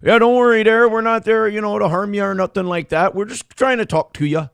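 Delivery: doing a Minnesota accent to mock the guy talking. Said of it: "this is the accent there".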